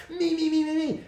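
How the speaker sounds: very high-pitched mocking chant; mock self-centred whining. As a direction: imitate someone self-obsessed, way up high. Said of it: "starts being less like".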